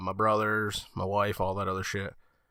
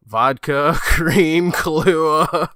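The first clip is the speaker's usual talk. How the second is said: listing ingredients; 6 semitones higher, 9 dB louder, much slower than usual. Retold or imitated.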